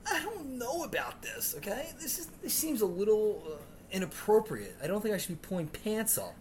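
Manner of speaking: high, whimpering complaint; whiny protest. Retold or imitated. Substitute whining to the teacher about helping kids. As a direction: whimper like you're about to cry.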